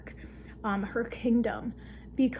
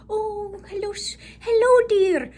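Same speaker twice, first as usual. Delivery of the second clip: high, louder sugary greeting; sweet old lady; 8 semitones higher, 6 dB louder than usual. Retold or imitated. [name] greeting Alara as a sweet old woman. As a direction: coo it like a kindly granny.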